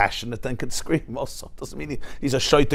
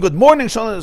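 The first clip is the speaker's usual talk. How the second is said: louder, higher greeting; mock scream; 8 semitones higher, 5 dB louder than usual. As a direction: call it out again, hammed-up. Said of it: "he screamed".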